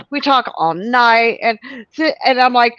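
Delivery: ordinary delivery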